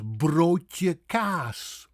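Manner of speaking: broodje kaas with a rolled r, long o and long open aa; slow, native Dutch broodje kaas; Dutch pronunciation. Shown as a demonstration. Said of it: "the way a Dutchman would say them".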